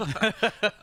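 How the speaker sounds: loud high laugh; burst of laughter. Real, not acted. Laughing at the joke.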